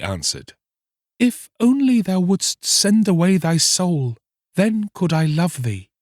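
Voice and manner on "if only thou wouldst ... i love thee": mermaid's voice. Acted. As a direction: gently refuse him, lifted high. Mermaid answers.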